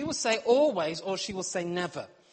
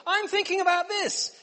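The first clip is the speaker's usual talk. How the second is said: high, louder sweet wife voice; mock wife asking; 11 semitones higher, 6 dB louder than usual. Wife asking her husband for something, high sweet voice. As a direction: ask sweetly like his wife.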